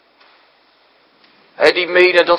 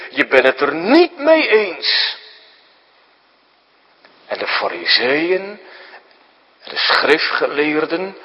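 ordinary delivery

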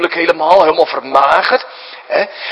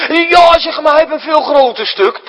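lightly voiced whiny child, much higher and louder; jealous child; 13 semitones higher, 6 dB louder than usual. Mother at Mother's Day, a child complains about the cake, mock whiny. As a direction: play the sulky kid who got less cake.

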